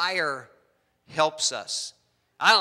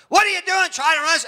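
much higher and louder angry shouting; mock furious yell; 13 semitones higher, 9 dB louder, faster than usual. Blind evangelist yelling out the window at nobody, loud furious shout. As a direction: yell at the other driver. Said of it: "And he's like".

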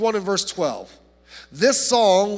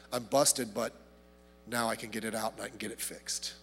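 softly, imagining David caught and relieved; a hushed admission of being busted; imagined relieved confession; 10 semitones lower, 14 dB quieter than usual. Retold or imitated. Pastor voices David relieved at being found out, hushed. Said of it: "And I could just imagine".

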